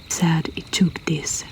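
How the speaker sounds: plain reading voice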